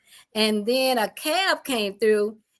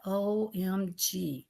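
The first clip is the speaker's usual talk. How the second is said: stunned slow OMG; 3 semitones lower, 9 dB quieter, much slower than usual. Retold or imitated. Stunned reaction.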